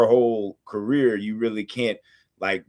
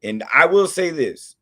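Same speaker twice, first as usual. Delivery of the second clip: ordinary talk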